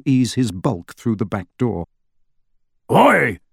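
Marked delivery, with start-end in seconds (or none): 2.9-3.4 louder, higher indignant shout; big man's indignant bellow at Lupin. A narrator's reading. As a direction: Hagrid protesting loudly. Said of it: "said Hagrid indignantly".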